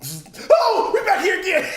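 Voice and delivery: high, loud laughing imitation; loud mock exclamation. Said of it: "it was like".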